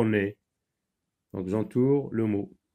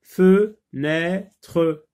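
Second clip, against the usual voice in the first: "Fenêtre" as fe... nê... tre, higher and louder; slow, raised "fe, nê, tre" with pauses; syllable by syllable; 6 semitones higher, 7 dB louder than usual. Shown as a demonstration. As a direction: sound out "Fenêtre" in three separate syllables. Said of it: "dans la deuxième syllabe".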